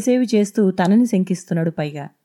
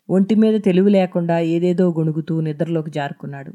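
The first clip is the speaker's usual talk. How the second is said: ordinary delivery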